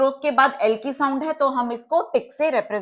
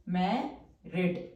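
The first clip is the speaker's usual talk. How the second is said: Married stretched very slowly, lower and softer, falling at the end; slow, spelled-out word; 6 semitones lower, 9 dB quieter, much slower than usual. Shown as a demonstration. Teacher slowly sounding out married for shorthand students, soft and drawn out. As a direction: draw out every sound of married. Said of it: "अब full stroke से represent करेंगे".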